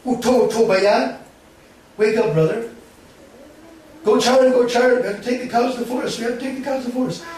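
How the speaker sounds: loud, high urgent call to wake. Acted. Dauji waking fainted Krishna for cowherding, loud urgent calling. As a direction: rouse a sleeping brother loudly.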